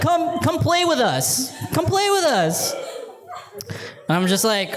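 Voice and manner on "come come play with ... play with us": put-on high, slow, sing-song kid voices; kids pleading. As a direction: draw it out like begging children. Kids begging in fantasy.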